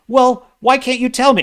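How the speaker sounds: hammed-up frustrated asking; whiny rookie dialogue.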